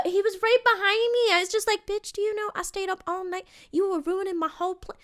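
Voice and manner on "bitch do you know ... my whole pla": high, soft mock complaint; exasperated rant held under the breath, pitched high. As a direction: fume at the kid in a high, small voice. Acted rant at sick child.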